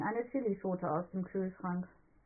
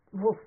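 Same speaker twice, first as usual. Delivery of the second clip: a playful little bark; dog woof; 7 dB louder than usual. A narrator's reading. Billy plays the dog in training, a woof. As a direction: bark once like an obedient dog. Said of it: "sagt Billy".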